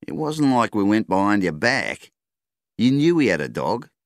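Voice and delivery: shooter's voice. A narrator's reading. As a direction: justify it to the farmer.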